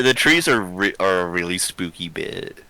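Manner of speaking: usual speaking voice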